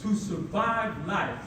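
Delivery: ordinary talk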